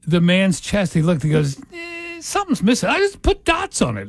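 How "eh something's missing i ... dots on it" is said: high-pitched put-on God voice; God musing. God looks over man's chest, mock musing. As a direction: think aloud in a high mock voice.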